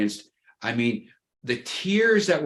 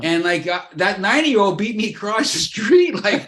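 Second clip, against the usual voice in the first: high, loud, worked-up comic complaint; mock exasperated rant; 7 semitones higher, 5 dB louder than usual. Host mocking himself to co-host, high and loud comic exasperation. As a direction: ham up being beaten across the street.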